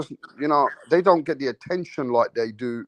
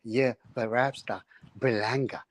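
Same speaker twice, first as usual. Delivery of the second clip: channel guest impression; 3 semitones lower than usual. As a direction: lightly imitate an obsessed boxing fan. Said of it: "He will come on the channel".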